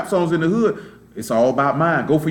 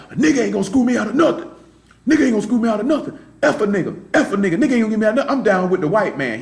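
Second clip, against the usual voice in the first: fast, higher mock rant going off; fast, high imitation of a friend ranting; mocking his rant; 4 semitones higher, faster than usual. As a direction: rattle it off fast and worked up, imitating him.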